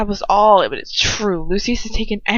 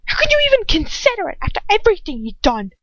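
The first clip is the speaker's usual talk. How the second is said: angry outburst; 11 semitones higher, 5 dB louder, faster than usual. A narrator's reading. Ron's continued outburst.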